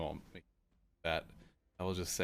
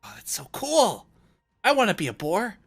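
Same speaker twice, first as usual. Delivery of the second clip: high, loud, awestruck character voice; overexcited kid, bursting with curiosity; giddy newcomer; 13 semitones higher, 14 dB louder than usual. Acted. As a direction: squeal with delight at every discovery. Giddy character discovers the boar.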